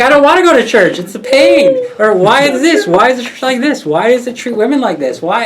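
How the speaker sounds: high loud whiny mimicry of her gripes; husband parroting his wife's old church complaints, high and whiny; mocking wife's complaints. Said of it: "And you're like".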